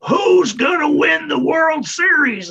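high, slow, hollered up at the stage; shouted question. Acted. Player shouting a question to the spirit, high and slow. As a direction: bellow it across the theater.

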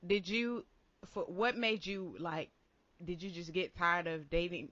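ordinary talk, nothing marked

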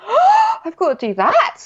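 high, laughing mock alarm; night panic. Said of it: "waking up in the middle of the night going".

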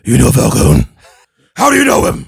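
hammed-up furious demand; shouted interrogation. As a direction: bellow the questions like an interrogator. Acting out film's interrogation scene.

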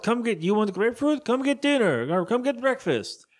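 high-pitched calling-out voice; calling to meals. Retold or imitated. Ron offers food to his guest, high calling voice. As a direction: call them in to eat, pitched high.

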